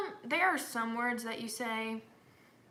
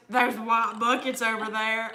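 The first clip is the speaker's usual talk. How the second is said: slow, louder, laughing through the quote; laughing mock echo; 9 dB louder, slower than usual. Friend echoing the answer back, laughing disbelief. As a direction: mimic the friend's baffled echo. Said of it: "And she goes".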